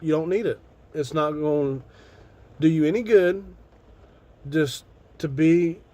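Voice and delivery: ordinary talk, nothing marked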